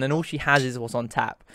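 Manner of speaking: ordinary talk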